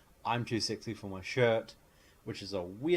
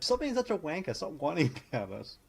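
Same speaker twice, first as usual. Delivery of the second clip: a hint of an exasperated woman, higher; mock scolding; 7 semitones higher than usual. Blondie to Ewan McGregor, lightly voiced scolding. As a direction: snap at him to quit whining. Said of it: "she's like".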